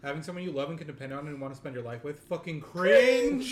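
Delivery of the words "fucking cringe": loud, drawn-out punchline; mock outburst. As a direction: blast the last word, stretched long. Mock verdict after sincere setup.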